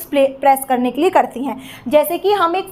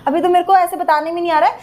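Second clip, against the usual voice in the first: ordinary talk, nothing marked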